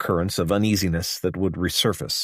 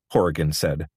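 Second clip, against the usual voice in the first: usual speaking voice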